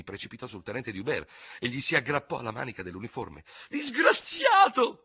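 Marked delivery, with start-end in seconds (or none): 3.7-5.0 high, slow, loud anguished wail; sobbing cry. Acted. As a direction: cry out the accusation through tears. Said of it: "singhiozzò". (grido singhiozzante, acuto e lento)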